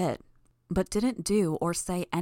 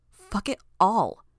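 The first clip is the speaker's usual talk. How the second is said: louder, slow, furious curse; angry; 4 semitones higher, 7 dB louder, slower than usual. A narrator's reading. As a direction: bite out each word hard. Repeated curse, now louder.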